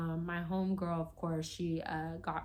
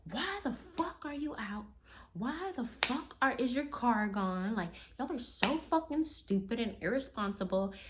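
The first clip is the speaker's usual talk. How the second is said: high-pitched imitation of an angry outburst; mock an enraged boyfriend's scolding; losing his mind; 6 semitones higher than usual. Boyfriend scolding drunk girlfriend over her car, losing his mind. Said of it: "lost his mind".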